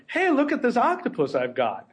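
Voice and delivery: lightly voiced excited rival scientist; a hint of a boastful colleague; other squid guy voice. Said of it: "that's your other squid guy voice?".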